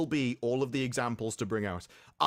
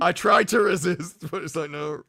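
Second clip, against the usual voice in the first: mock protest; 5 semitones higher, 7 dB louder than usual.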